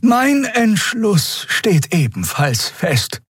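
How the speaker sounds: put-on high, very slow old voice; resolute elder. A narrator's reading. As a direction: draw each word out in a high old voice. (bedächtige, hohe Altersstimme)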